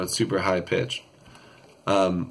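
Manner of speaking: ordinary talk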